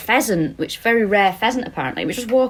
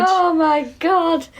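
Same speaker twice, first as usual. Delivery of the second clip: surprised; 9 semitones higher, much slower than usual. Real, not acted. Delighted by the pun.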